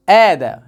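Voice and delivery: آ held long and loud, دا cut short; long then short. Shown as a demonstration. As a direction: stretch آ, then say دا briefly. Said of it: "دي قصيرة، دي اللي طويلة". (آ ممدودة ودا قصيرة)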